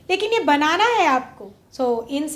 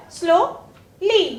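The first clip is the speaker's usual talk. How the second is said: Slowly very slow, pause between slow and ली; slow teacherly slowly in two separate beats; two syllables; much slower than usual. Shown as a demonstration. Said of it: "टू syllable है".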